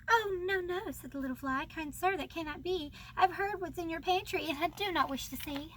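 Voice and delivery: put-on reading the old verses aloud with gentle lilt; poem reading. Reading a classic children's poem to viewers, storybook recital.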